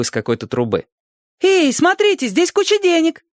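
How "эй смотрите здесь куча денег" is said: put-on very high child's call; excited little boy. A narrator's reading. Small boy showing everyone money, excited high voice. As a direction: squeal the news like a small boy. Мальчик кричит остальным детям.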